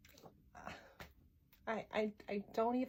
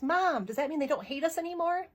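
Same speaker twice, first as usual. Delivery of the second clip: higher, louder eager question; hopeful child; 6 semitones higher, 6 dB louder than usual. Retold or imitated. Daughter runs up to mom as signs come down, hopeful. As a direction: voice an excited child, pitch lifted. Voices excited daughter running up.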